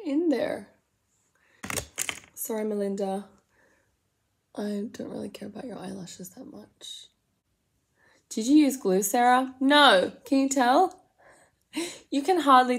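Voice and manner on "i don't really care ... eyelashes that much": unvoiced confiding whisper. Real, not acted. Whispering an apology to the doll Melinda. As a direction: confess it quietly to the doll.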